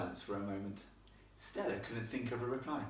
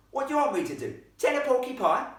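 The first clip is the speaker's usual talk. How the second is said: loud, high Cockney retort; indignant Cockney ghost boy; 11 dB louder than usual. Acted. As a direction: fire back as an annoyed Cockney lad. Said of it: "Cockney rhyming slang".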